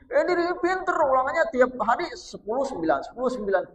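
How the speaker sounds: high-pitched, loud mock boasting; bragging pupil. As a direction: brag like a proud schoolkid. Mocking a proud smart pupil. (pamer seperti murid sombong, nada tinggi dan keras)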